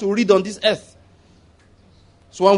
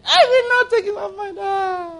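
wailing and half-laughing, much higher; mock wail; 12 semitones higher than usual. Preacher mocking a woman's tearful vow, hammed-up wail. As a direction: hams up her tearful cry, shouting.

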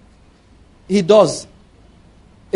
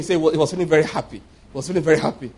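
ordinary delivery

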